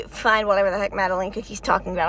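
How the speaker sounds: ordinary talk, nothing marked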